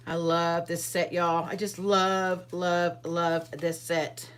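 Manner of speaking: slow, savoring each love; gushing. Real, not acted. Crafter gushing to viewers about her stamp set: slow and fond. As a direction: linger lovingly on every love.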